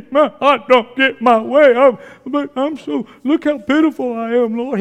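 mocking a believer pleading pitifully to the Lord; mock whining. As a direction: whine it like a spoiled child. Mocking whiny Christians praying.

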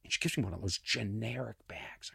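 whispered aside. Real, not acted. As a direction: drop to a breathy whisper. Aside about the generic bag.

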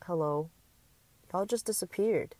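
ordinary delivery

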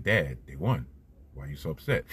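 a hint of a sleepy kid, low and soft; just woken son, puzzled, barely acted; lightly voiced son. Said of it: "he's like".

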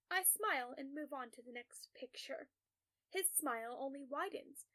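plain reading voice

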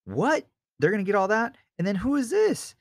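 squeaky high disbelief; can't believe the reward or the giant; incredulous. Retold or imitated. David scoffing at the Philistine, high and incredulous. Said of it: "he's like".

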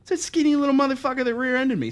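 high, fast indignant outburst; mad. Retold or imitated. Storyteller reading the lawsuit papers, retold anger. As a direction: rattle it out, worked up. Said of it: "I got so mad".

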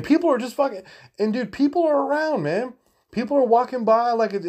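very high-pitched, worked-up storytelling; animated disbelief. Real, not acted. Telling of people walking by, animated disbelief. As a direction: pitch it way up, amazed at the nerve. Recounting digging coins in public.

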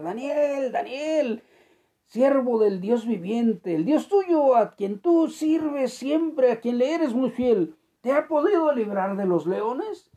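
loud, high, slow call down into the pit. Acted. King calling down into the lions' den at dawn, loud and slow. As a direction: shout for Daniel in hope.

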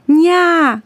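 high, loud call of a name. A narrator's reading. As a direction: call her across the yard. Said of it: "zawołał kucyk".

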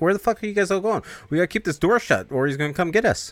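high-pitched, indignant call for help; acted urgent plea, pitched well up; urgent protest. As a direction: retell it as an alarmed, exasperated demand. Acts Spider yelling for help.

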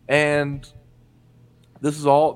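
normal talking voice

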